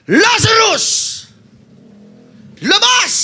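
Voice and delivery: high, loud commanding call; Jesus crying out. Acted. Jesus cries out to dead Lazarus, loud high command. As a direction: call Lazarus from the tomb at full voice. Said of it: "Sumigaw siya".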